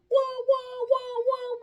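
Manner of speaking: high, loud siren wail; ambulance wail. Retold or imitated. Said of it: "like an ambulance".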